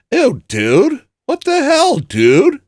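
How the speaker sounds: high, loud disgusted squeal; grossed-out shock. Real, not acted. Streamer recoils at a chat message, high and loud. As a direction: recoil in mock horror.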